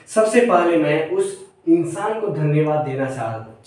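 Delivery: ordinary delivery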